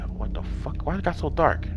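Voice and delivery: hushed, breathless curse; scared whisper. Real, not acted. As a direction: whisper it in fear.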